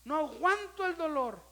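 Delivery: high, loud pleading to God; imitating an anguished hospital prayer; retold cry of pain. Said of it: "de veras era esa expresión".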